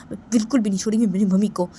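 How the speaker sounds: loud, fast defiant outburst; heated, rushed determination; angry vow. Acted. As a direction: burst out with a furious threat. Vows to confront her mother.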